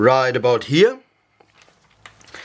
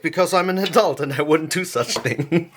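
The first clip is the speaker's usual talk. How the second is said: ordinary talk, nothing marked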